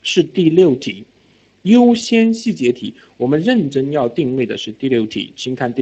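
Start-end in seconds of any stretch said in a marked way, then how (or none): 0.0-2.9 slow and weighty, word by word; teacher tells students to listen, then slows down; slow emphatic. Shown as a demonstration. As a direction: hammer it home slowly. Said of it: "同学们听好了".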